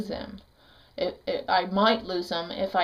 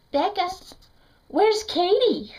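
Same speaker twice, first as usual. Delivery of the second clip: supervisor calling out; 10 semitones higher, 4 dB louder than usual. Retold or imitated. Visitation supervisor asks the mother where her daughter is, calling high. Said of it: "the supervisor, she said".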